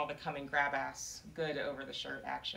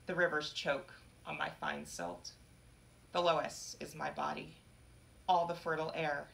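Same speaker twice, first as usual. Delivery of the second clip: ordinary delivery, nothing marked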